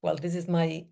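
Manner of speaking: ordinary talk, nothing marked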